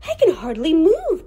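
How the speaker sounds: crying out. A narrator's reading. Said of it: "cried Grasper".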